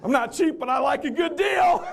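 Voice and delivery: high, loud, laughing shout; laughing punchline. Real, not acted. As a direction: crack up as you deliver it.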